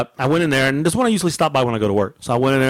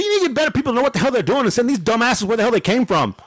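high-pitched indignant rant; retold tirade; 8 semitones higher than usual. Customer walking out past cashier, pissed-off tirade. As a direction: replay the rant with raised pitch. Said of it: "he hears my tirade".

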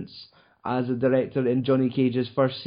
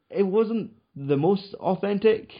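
ordinary talk

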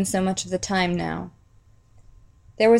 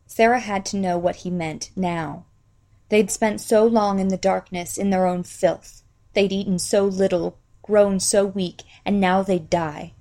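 ordinary delivery, nothing marked

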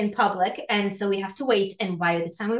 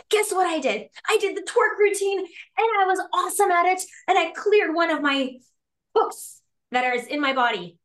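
much higher, bubbly excited brag; excited bragging; 9 semitones higher than usual. Retold or imitated. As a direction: gush about it to your best friend. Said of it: "best friend, who's like".

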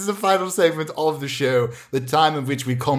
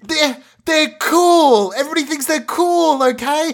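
put-on defensive teenage son; 12 semitones higher than usual. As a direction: sulk and insist like a teenager. Acted teen son defending himself.

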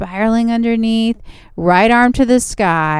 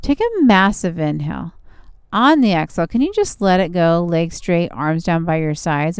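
calm and soothing, easing into rest; 3 semitones lower than usual. Acted. Yoga relaxation cool-down.

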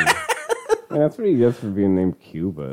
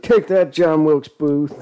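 Lincoln; 5 semitones higher, slower than usual. Pretend. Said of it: "I'm just like".

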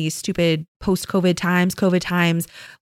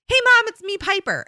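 put-on much higher bouncy kid announcing herself; toddler voice; 14 semitones higher than usual. Mom imitating her toddler bursting into the room, much higher kid voice. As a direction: sing it out high like a tiny child. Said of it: "she'll go".

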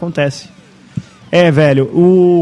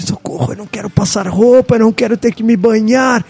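higher, strained plea for help, held back in volume; mock muffled scream; 5 semitones higher than usual. Podcaster voicing his friend's inner cry for help, mock screaming. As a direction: imitate a desperate inner voice crying out. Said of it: "ele tá gritando. Ele fala assim".

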